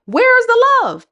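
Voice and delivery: high loud "Where is the love?"; dramatic rising call; excited exclaim. Real, not acted. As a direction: announce the big question. Exclaimed key question.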